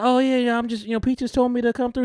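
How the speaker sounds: high-pitched imitation making excuses; nervous intruder impression.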